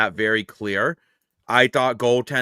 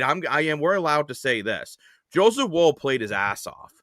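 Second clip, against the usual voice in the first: usual speaking voice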